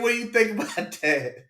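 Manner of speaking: usual speaking voice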